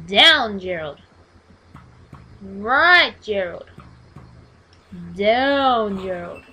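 loud barked orders; shouted commands. Real, not acted. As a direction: yell directions at him.

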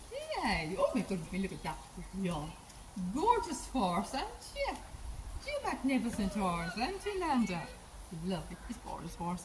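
high, soft pet-talk voice; soft high sing-song pet talk; cooing to a horse. Real, not acted.

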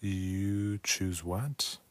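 very slow, word by word; slow translating. Real, not acted. Learner slowly working out the meaning. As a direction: sound it out as you think.

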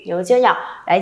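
ordinary talk

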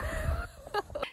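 squealing laugh; high squeal of a laugh. Real, not acted. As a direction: shriek with laughter. High laugh after joke.

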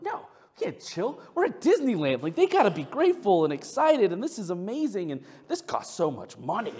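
high, loud mock outburst; exasperated protest. Dad arguing with his wife at Disneyland, exasperated. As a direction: argue back, re-enacting the indignation, easing off at the end.

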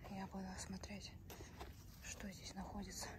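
hushed nervous whisper. Real, not acted. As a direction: whisper in the dark tunnel. Whispering while exploring dark tunnel.

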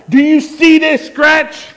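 high, loud, drawn-out demand; exasperated outrage. Retold or imitated. Mad dad insisting someone sees the scratch, exasperated. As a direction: stretch it out, insisting they look.

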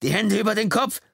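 high loud shouted order; roared command. Acted. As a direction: bellow at the driver to get out. Cop yelling at suspect.

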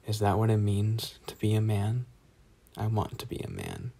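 ordinary delivery

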